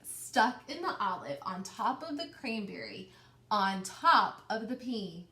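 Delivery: rhythmic piling-up read-aloud voice; story narration. A narrator's reading. Teacher reading the growing tower to her class, rhythmic storytelling. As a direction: build the stack step by step for the kids.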